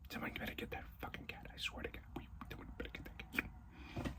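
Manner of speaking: fast, barely audible whispered swearing; urgent whisper. Acted. Viewer fretting over the noisy cat, urgent whisper. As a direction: hiss it under your breath, hurried. Viewer's tense reaction during silent scene.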